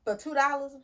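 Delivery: usual speaking voice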